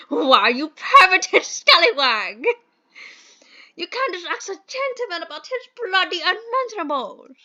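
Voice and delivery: a scandalized English gentleman sputtering; outraged posh gentleman. Acted. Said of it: "I hate this accent".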